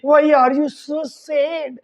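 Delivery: drawn-out, word by word; slow teaching model line. Shown as a demonstration. Teacher repeats the husband's question very slowly. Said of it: "उसको English में बोलना".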